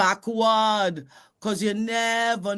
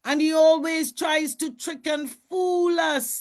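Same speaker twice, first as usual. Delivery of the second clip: angry animals; 9 semitones higher, 3 dB louder than usual. Acted. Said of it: "they said".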